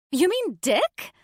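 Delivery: squeaky excited question; high-pitched and excited. A narrator's reading. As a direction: pitch it up, giddy. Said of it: "a little too high-pitched and excited".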